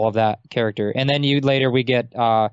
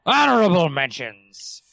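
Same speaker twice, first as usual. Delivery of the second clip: pirate voice. Pretend. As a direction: ham up an old sea pirate. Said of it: "I gotta do the pirate voice".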